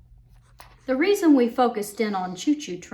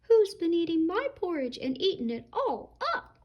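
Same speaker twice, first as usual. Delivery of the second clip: put-on higher, little baby rhino wail; small wailing Baby Rhino; 8 semitones higher than usual. Baby Rhino finding his porridge all gone, small higher wail. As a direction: whine it like a tiny upset baby. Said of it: "wailed Baby Rhino in his small little voice".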